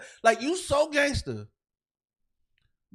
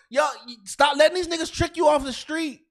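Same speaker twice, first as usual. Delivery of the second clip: usual speaking voice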